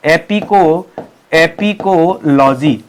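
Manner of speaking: "Apicology" drawn out syllable by syllable; slow dictation. Shown as a demonstration. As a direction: stretch each part of "apicology" for note-takers. Teacher dictates the new term.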